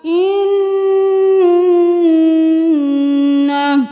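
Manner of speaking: इन्ना with the doubled n hummed long in the nose; ghunna held. Shown as a demonstration. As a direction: stretch the nasal n of इन्ना. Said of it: "नून पे तशदीद है, गुन्ना".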